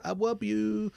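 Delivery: high, little granddaughter saying it; a lightly voiced little child. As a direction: imitate a toddler's first I love you. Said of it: "And she goes".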